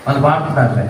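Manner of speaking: low, indifferent shrug of a delivery; half-hearted and low; joyless. Retold or imitated. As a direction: mimic a bored onlooker, pitch dropped. Interpreter mirrors the joyless indifference.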